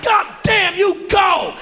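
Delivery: high, loud cheer; jury cheering shout. Acted. Jury cheering the wife in court, loud and high. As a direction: cheer her on. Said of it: "the jury would have been".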